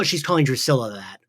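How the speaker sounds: ordinary delivery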